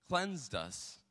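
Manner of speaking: ordinary talk, nothing marked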